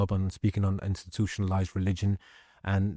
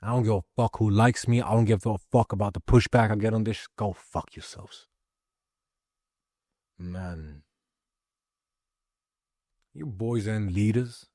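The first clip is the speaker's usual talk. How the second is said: ordinary delivery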